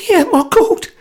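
high nervous squeak. A narrator's reading. Frightened man squeaks his dismay, high. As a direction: squeak it out, panicky. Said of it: "he squeaked under his breath".

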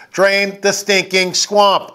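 slow, deliberate, drawn-out words; slow slogan. Real, not acted. Host urging viewers with a slow slogan. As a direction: spell out the slogan slowly.

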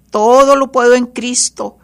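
loud, high proclamation of "todo lo puedo en Cristo"; shouted declaration of faith. Real, not acted. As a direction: cry it out to the whole church.

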